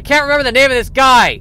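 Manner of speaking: frustrated outburst. Real, not acted. Podcaster fed up at forgetting a name, frustrated loud outburst. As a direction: blurt it out, fed up with himself.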